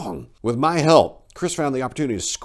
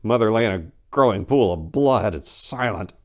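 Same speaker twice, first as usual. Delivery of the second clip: ordinary delivery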